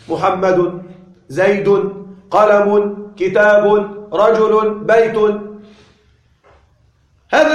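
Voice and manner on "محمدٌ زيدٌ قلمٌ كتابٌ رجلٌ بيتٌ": each word from محمد to بيت ends in a sounded -un; with tanween. Shown as a demonstration. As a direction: list محمد، زيد، قلم with ringing -un endings. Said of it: "يكون فيها تنوين". (بالتنوين، كل كلمة تنتهي بنون ساكنة)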